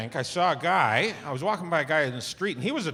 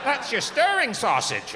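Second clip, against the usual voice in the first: higher, slow, proud server voice; cheery pub server; 9 semitones higher than usual. Retold or imitated. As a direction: present the sausage like a proud host.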